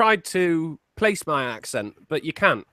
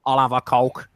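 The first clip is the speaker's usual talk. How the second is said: low, deadpan Scouse imitation; mimicking a terse Scouse lad; Scouse guy; 7 semitones lower than usual. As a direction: drop low into a Liverpool accent. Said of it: "I went to Amsterdam with a Scouse guy".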